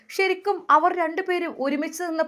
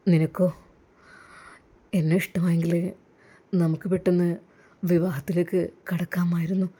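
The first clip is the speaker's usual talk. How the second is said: put-on lower, gentle young man's voice; lower, tender groom talking to his bride-to-be; the groom's voice; 8 semitones lower than usual. A narrator's reading. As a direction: speak as the suitor who wins her over. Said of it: "അയാളുടെ ആ ശബ്ദം പുറത്തേക്ക് വന്നത്".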